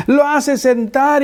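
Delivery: ordinary delivery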